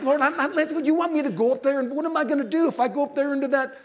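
high, loud pleading protest to God; whiny protest. Retold or imitated. Preacher mimics himself refusing God, high whiny protest. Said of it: "'cause I'm like".

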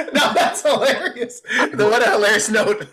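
high-pitched words through real laughter; laughing. Real, not acted. Actor delighted by a friend's joke note, laughing. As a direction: crack up while replying.

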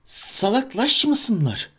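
high, slow hissed scolding; Gollum hiss. Acted. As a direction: hiss it like a creature. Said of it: "diye tısladı".

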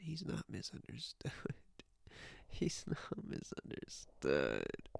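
frustrated whispered muttering. Real, not acted. Streamer whispering to herself at the top answer, exasperated. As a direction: hiss it under your breath, exasperated.

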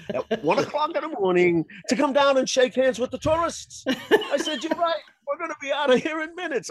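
high, fast, giggling words; can barely get it out for laughing; laughing through it. Real, not acted. Laughing through the retold banter.

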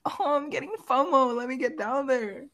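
put-on very high girly voice; mock eager girls. Mocking girls who spot a crowd, high-pitched eagerness. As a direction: mimic an overexcited girl in falsetto. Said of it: "They're like".

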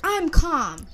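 shouted high and loud, imitating the attorney; mock angry shout. Attorney snapping back at the judge, in a loud mock shout. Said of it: "He's like".

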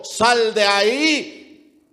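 loud, drawn-out shout of command. Acted. Preacher as the Lord calling Lazarus out, commanding shout. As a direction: call it out slowly, as an order. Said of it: "con voz de mando".

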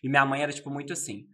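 ordinary talk, nothing marked

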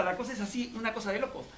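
ordinary talk, nothing marked

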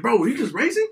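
high-pitched excited question; excited passenger. Retold or imitated. Passenger turns to the driver at the light, all excited. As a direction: ask it eagerly, pitch lifted. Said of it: "he looks at me all excited".

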